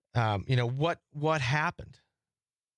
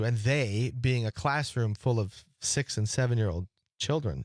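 ordinary talk, nothing marked

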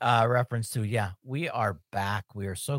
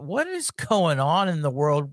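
normal talking voice

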